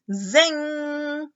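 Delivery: playful zing; zingy sound word. A narrator's reading. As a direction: ring it out.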